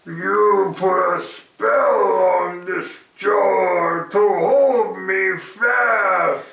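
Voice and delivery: loud, slow wailing goblin; accusing Herschel while stuck; distressed goblin. Acted.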